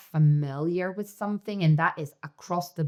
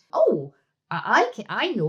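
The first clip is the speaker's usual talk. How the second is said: high, louder burst of pride; proud child; 6 semitones higher, 5 dB louder than usual. Acted. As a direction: exclaim like a child proud of knowing. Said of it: "the child feels proud".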